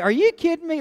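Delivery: high, loud mock disbelief; amazed disbelief. Pastor offered him the pulpit, retelling his high loud disbelief. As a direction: squeal it like you can't believe the offer. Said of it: "I was like".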